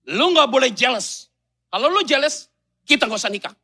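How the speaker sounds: high, loud laying down terms; mock bossy bride setting marriage terms; demanding bride. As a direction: dictate conditions defiantly.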